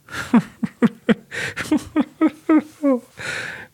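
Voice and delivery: high, dry, uncanny cackle; Plyushkin's dry, almost sinister laugh; dry eerie laugh. A narrator's reading. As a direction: let out a creepy dry laugh. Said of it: "Ein trockenes, fast unheimliches Lachen".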